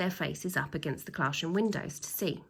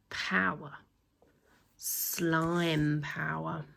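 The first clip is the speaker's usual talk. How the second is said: dramatic hush; 3 semitones lower, much slower than usual. A narrator's reading. Dramatic reveal of power.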